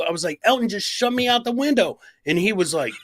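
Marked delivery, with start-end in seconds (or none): 0.4-2.0 high-pitched retold tattling; acted high complaint to dad; protesting kid. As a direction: plead your case like a wronged kid.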